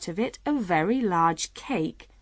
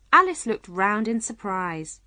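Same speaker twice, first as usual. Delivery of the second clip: ordinary delivery, nothing marked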